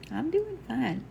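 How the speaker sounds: a sweet Southern lady, lightly twangy; light Southern twang. Retold or imitated. Medium reading imitating grandma's light Southern twang. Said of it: "That was the cutest fucking thing".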